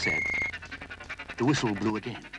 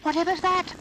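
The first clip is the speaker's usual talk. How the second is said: very high, loud startled cry; Noddy startled by a train; frightened toy; 7 dB louder than usual. Acted. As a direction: gasp it out in fright.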